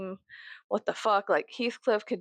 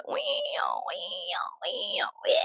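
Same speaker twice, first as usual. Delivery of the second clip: imitating bug noise; 3 semitones lower, 3 dB quieter, much slower than usual. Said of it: "what bug that is".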